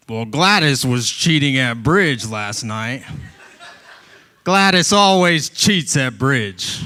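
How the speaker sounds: lightly voiced old man, slow and griping; a hint of a cranky grandpa tattling, slow; grumpy grandpa. Grandpa griping to his son about Gladys, a lightly voiced slow old man.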